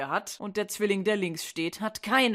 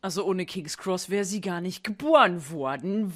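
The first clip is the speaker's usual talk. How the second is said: ordinary delivery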